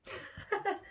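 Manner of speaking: soft high giggle; short, quiet tittering laugh; amused laugh. Real, not acted. Amused after stating her name.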